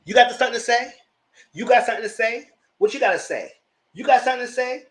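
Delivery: loud, high-pitched confrontational demand; Candace's challenge. Retold or imitated. Candace calling out the cast, loud and high. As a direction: throw down the challenge. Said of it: "So Candace said".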